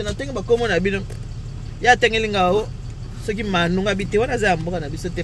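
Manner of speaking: ordinary talk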